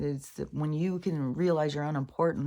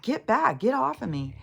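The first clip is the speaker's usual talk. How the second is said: higher, louder imitated shout to back off; retold yell, raised and higher; mock yell; 8 semitones higher, 4 dB louder than usual. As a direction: play the cornered man yelling him away. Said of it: "the guy's like".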